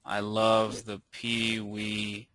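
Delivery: very slow, drawn-out words with gaps; typing aloud. Shown as a demonstration. Presenter typing a demo review for viewers, slow dictation. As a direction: say each word at typing speed, voice sinking soft and low.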